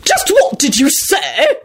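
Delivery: high, slow, affronted screech; imperious rising shriek. A narrator's reading. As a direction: draw out each word in outrage. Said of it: "Lady Fanshawe screeched".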